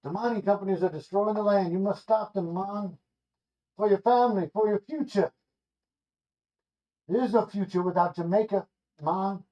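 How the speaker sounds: solemn Rasta prophet in a vision, light Jamaican lilt; [name]. Acted. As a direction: Rasta seer warning Johnny in a light Jamaican accent. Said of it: "[name] in all of his Rastafarian glory".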